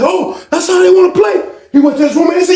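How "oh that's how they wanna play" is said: high, heated outburst; angry challenge. Retold or imitated. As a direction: throw it out as a threat. Acts out angry victim.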